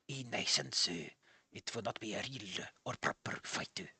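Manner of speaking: hushed, low mock-Japanese old man; fake Japanese accent. Old man explains the sparring, fake Japanese accent. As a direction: add stray vowels in a quiet Japanese accent. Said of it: "end your fake Japanese accent on".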